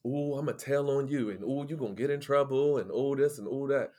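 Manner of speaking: mild taunting ooh voice; lightly mocking singsong taunt; sneaky tattletale. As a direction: tease like a snitch. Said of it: "very sneaky".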